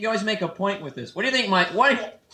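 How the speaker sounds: normal talking voice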